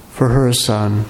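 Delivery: ordinary talk, nothing marked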